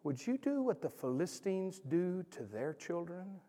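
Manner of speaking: soft, slow pleading question; entreating voice. Acted. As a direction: gently beg him, slowly, soft. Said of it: "in this entreating voice".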